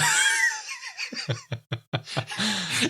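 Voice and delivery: high squealing laugh burst; shrieking laugh. Real, not acted. Talked himself out of it.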